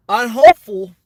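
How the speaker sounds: hopeful belted out loud like a campaign rally; loud mock-presidential emphasis. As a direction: boom it out to the crowd. Repeats phrase, second much louder.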